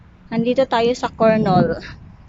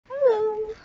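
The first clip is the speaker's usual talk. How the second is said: ordinary talk, nothing marked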